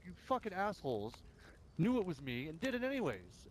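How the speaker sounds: high, heated scolding; retold angry accusation. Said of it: "So I'm like".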